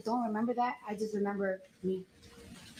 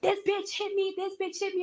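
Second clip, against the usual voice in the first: imitated wilding out; 9 semitones higher than usual. Guard accusing her before the receptionist, imitated wilding out. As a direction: mimic her hysterical outcry on a high pitch. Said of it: "wilding out, like".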